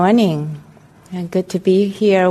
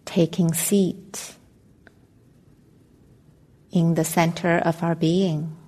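calm, measured meditation voice with long pauses; guided meditation. Acted. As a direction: guide listeners gently into stillness, leaving space between phrases.

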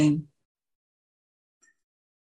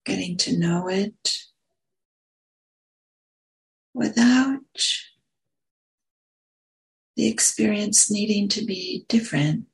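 unhurried meditation guidance with long pauses; guided meditation; 3 semitones higher than usual. Real, not acted. Meditation teacher settling listeners into posture and breath, guided meditation. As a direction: ease them gently into stillness, pausing between phrases.

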